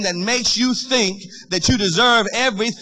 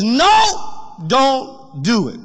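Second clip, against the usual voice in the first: Father's warning; 4 semitones higher, much slower than usual. Retold or imitated. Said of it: "He says".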